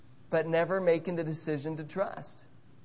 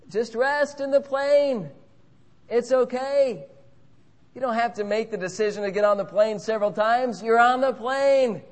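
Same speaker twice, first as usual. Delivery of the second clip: soothing stewardess; 6 semitones higher, 6 dB louder than usual. Pretend. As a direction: talk him down gently like a flight attendant. Stewardess calming nervous passenger.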